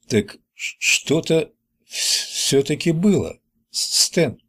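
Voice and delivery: stuttering on first sounds, catching before each word; заикание. Acted. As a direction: stutter haltingly through the question. Билл заикается, спрашивая Стэна. (заикаясь, с запинками)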